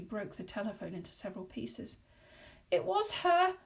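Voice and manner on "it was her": high, frightened whimper. Acted. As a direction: whimper it in terror. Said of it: "Missus Warden whimpered".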